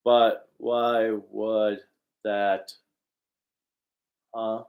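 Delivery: word by word, with big gaps; hammy cartoon actor, haltingly slow; eighties cartoon voice actor. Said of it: "sound like an eighties cartoon voice actor".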